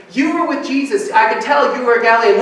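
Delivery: mounting, insistent accuser; acted accusation. Accuser escalates in acted scene.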